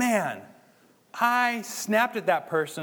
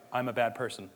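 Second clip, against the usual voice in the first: low, soft, mopey self-talk; a hint of gloomy self-condemnation; self-pitying mope; 6 semitones lower, 6 dB quieter than usual. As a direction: mumble it like wallowing in guilt. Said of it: "sit on it and be like".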